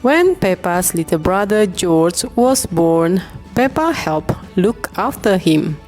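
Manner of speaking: plain reading voice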